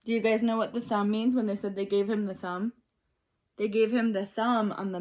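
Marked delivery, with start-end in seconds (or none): none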